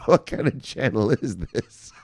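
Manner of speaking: slow, put-on voice of a puzzled newcomer; mock viewer voice. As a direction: drag it out like a confused new viewer. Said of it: "you're like".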